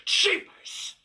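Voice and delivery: raspy spooky whisper. Pretend. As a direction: breathe it like a creepy chant.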